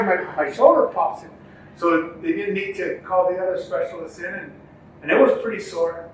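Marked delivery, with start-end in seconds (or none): none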